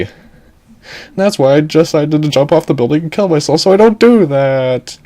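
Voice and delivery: high-pitched put-on girl voice; fretful damsel. Damsel explaining her curse to the captain, high fretful voice.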